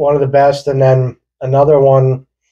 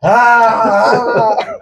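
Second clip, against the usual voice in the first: high-pitched mock scream; drawn-out terrified "ah", played for laughs; imitated scream; 9 semitones higher than usual. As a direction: imitate someone screaming bloody murder. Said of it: "Both of them are screaming bloody murder".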